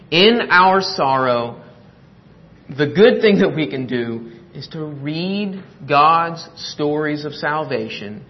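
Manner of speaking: ordinary talk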